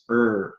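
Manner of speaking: "er" with a full North American R; R sound demonstrated. Shown as a demonstration. Coach showing a sound not used in England, "er" fully R-coloured. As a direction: sound out "er" as one R-coloured vowel. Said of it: "we have a sound not used in England".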